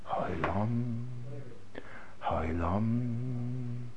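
soft, drawn-out, hushed; hushed awe. Shown as a demonstration. Rabbi demonstrating hushed wonder for students. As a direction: let it die into awe. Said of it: "my intonation, my voice".

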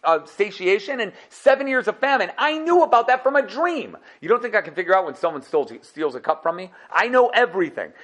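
hammed-up boastful king; Yosef proclaiming. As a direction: announce your rank grandly. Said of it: "said Yosef".

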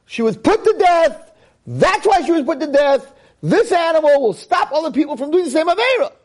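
high, fast, rising proclamation; rabbi's proclamation. Retold or imitated. Rabbi of the town ruling, high rising fervor. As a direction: build it up into a fiery ruling. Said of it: "I would say".

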